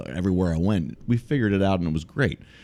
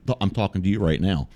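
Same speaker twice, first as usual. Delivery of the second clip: usual speaking voice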